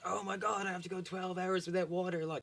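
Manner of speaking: high whiny mock panic; hammed-up spoiled complainer; freaking out. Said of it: "you saw people freaking out over Twitter".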